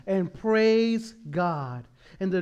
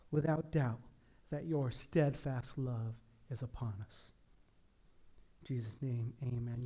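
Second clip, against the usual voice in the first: soft prayerful voice fading lower; 5 semitones lower, 4 dB quieter than usual. Acted. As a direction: pray it low and hushed. Closing prayer.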